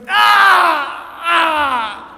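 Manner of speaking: loud high wail of pain; imitated scream. Retelling how the injured woman cried out, screaming. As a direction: scream out in agony, full voice. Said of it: "no exaggeration".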